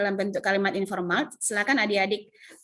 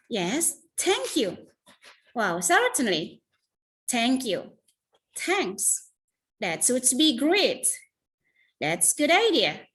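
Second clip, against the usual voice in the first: bright happy thanks, slower; cheerful, grateful; much slower than usual. Shown as a demonstration. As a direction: sound truly glad to be helped. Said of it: "Harus muka bahagia karena seneng".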